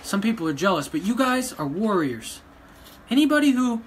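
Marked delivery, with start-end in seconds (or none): 3.1-3.8 loud and higher, cutting in hard; a loud, heated burst in a rant; sudden loud outburst. Real, not acted. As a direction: blurt it out angrily. Angry rant at online critics.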